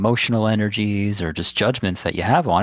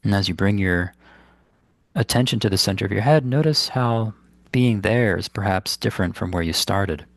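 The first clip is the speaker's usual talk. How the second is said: unhurried phrases with long, restful pauses; guided meditation; 2 semitones higher than usual. Acted. Meditation teacher guiding listeners to ground the body, in guided meditation style. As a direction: guide them gently inward, leaving space between instructions.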